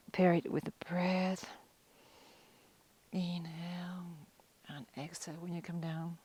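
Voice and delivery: soft, unhurried yoga cueing, long pauses; calm breath guidance. Real, not acted. Guides slow breathing stretch.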